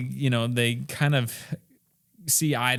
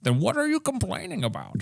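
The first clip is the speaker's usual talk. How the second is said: higher put-on retort of a fed-up dad; mock exasperated father; 8 semitones higher than usual. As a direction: snap back like an irritated father.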